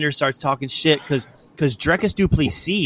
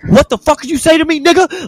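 much higher and much louder, enraged outburst; furious yell; 7 dB louder than usual. Retold or imitated. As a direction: explode at him in rage. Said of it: "And Stylebender goes".

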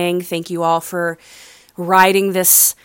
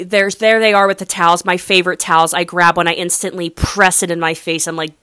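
ordinary talk

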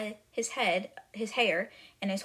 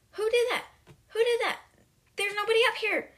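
very high, loud mock outrage; outraged demand; 13 semitones higher, 5 dB louder, faster than usual. Splashed headmistress facing the class, high outraged demand. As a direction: act out a shrieked demand for the culprit.

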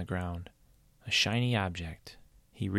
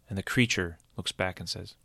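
ordinary delivery

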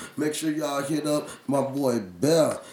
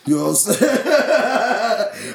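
laughing; 8 semitones higher, 8 dB louder than usual. Real, not acted. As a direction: crack up mid-sentence. Laughing after admitting he's high.

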